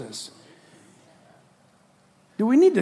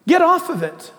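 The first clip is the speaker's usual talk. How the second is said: loud, raised urgent command; loud insistent preacher command; Peter's command; 8 semitones higher, 4 dB louder than usual. Acted. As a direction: call it out firmly, like an apostle's order. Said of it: "Peter says".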